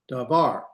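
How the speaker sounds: Davar with the accent on the second syllable, var; stress on final syllable. Shown as a demonstration. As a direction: say Davar, leaning on the ending var. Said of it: "put the accent over here".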